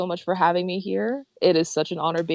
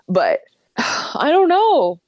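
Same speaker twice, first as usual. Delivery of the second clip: high, loud, slow sighing; overwhelmed sigh; 8 semitones higher, 6 dB louder, much slower than usual. Real, not acted. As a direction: let it out with a big sigh.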